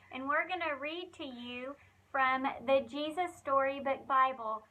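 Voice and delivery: usual speaking voice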